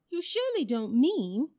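high, loud bird voice; incredulous jackdaw. A narrator's reading. Jackdaw disputes with the badger, loudly incredulous. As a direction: challenge it loudly, pitched well up.

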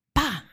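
high, percussive "pá"; imitated slap sound. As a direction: smack the syllable out like a slap.

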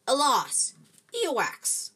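loud, high cry of dismay; choked exclamation. A narrator's reading. Dumbledore tasting a bad sweet, loud dismay. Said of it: "Then he choked on it and said".

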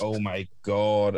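very slow weary sigh of words; exasperated. Retold or imitated. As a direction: drag it out in disbelief. Retold exasperation.

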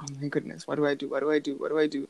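hushed, quick panicky muttering to herself; mock panic under her breath; frantic inner voice; panicked whisper. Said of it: "I would just start panicking".

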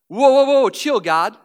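mock alarm. Pastor playing a stingy fry owner, loud and high. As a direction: cry out to guard your fries. Acted stingy person guarding fries.

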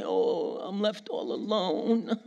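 slow, whiny mock self-pity voice; hammed-up pity party lament. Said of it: "having a pity party about it and say".

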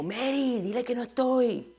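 high, soft mock call; imitated grandfather. Grandfather calling to his wife to cover for him, soft high imitation.

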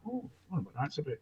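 quietly wondering to himself; inner thought. Retold or imitated. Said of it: "And I thought".

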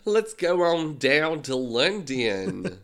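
put-on terrible British accent, slow and drawn out; a clumsy try at British, slowly; bad British accent. Friend joking about London, bad British accent. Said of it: "That is a terrible British accent".

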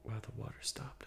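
hushed unvoiced aside; whispering. Real, not acted. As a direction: breathe it out as a whisper. Quiet aside about the water.

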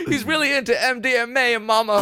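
mock dying soldier lament; a high, wailing voice for mama; Southerner dying at war. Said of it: "I like talking like a, a Southerner dying at war".

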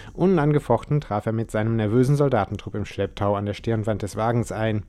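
usual speaking voice

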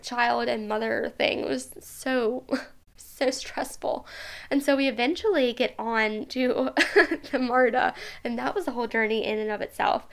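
normal talking voice

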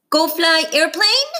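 put-on high, childlike question; a toddler asking about the plane; high small-child voice; little child. A narrator's reading. Small child Penny asks.

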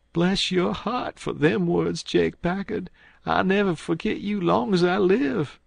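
blubbering. A narrator's reading. Man on the floor thanks Jake Packard for sparing him, blubbering. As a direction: plead gratefully in a high blubbering voice. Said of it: "sort of blubbering".

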